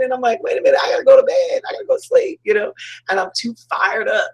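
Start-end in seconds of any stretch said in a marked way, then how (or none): 0.4-2.4 very high, rushed self-talk; mock squeal of being too wound up; super excited. Speaker to herself at bedtime, excited high squeal. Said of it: "and I get super excited".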